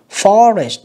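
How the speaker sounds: "Forest" with a strong, slow FOR; first-syllable stress. Shown as a demonstration. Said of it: "âm một nha các bạn". ("Forest" nhấn âm một)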